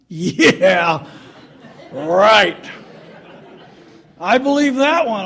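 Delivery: loud mocking yeah right; sarcastic disbelief. Son mocking dad's claim that the spanking hurt him, loud sarcasm. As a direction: scoff at dad's line out loud. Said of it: "in my mind, I thought".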